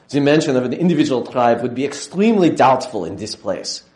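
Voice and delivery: full mock German accent, scholarly and dismissive; imitating a German professor. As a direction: quote the German editor in his accent. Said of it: "I should do it in his German accent".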